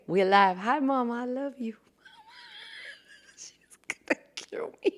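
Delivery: ordinary talk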